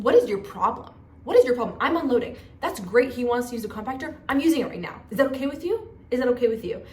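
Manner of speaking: heated, re-enacted comeback; indignant retort. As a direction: act out snapping back at a scolding stranger. Acts out her quarrel with the man.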